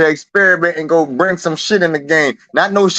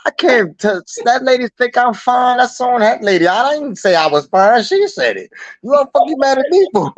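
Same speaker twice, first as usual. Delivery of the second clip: mock exasperated rant; 7 semitones higher than usual. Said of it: "I'm like".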